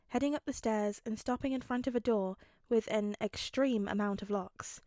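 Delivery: usual speaking voice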